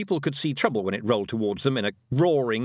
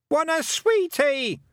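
put-on high, loud, slow toddler shout; a small boy yelling for sweets; excited little boy shouting; child's shout; 4 dB louder, slower than usual. A narrator's reading. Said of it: "shouted Wentworth".

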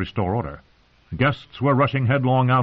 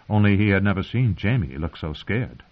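plain reading voice

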